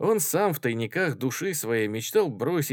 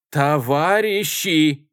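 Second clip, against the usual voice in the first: loud, drawn-out, raised call; shouting over everyone; 7 semitones higher, 9 dB louder, much slower than usual. A narrator's reading. Chairwoman calling the meeting to order, shouting. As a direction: shout the room down. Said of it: "перекрикивая всех, закричала Варя Мартова".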